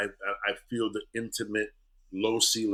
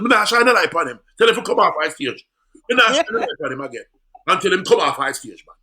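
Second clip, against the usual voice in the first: loud, high shouting in patois; hollered Jamaican protest; indignant Jamaican refusal; 9 semitones higher, 11 dB louder than usual. Retold or imitated. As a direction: play an outraged Jamaican stagehand yelling his refusal. Acts out spotlight man refusing, shouting.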